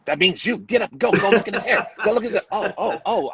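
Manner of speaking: high, rushed self-urging, breaking into a laugh; excited nervous push to get moving; frantic urging. Retold or imitated. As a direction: hurry yourself up in a panic. Retold set panic, urging himself.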